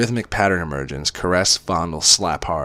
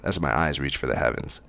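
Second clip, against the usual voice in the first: ordinary delivery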